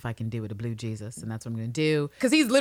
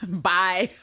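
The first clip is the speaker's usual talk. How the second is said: normal talking voice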